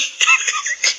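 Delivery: an airy burst of laughter; breathy laugh. Real, not acted. Laughs at own story.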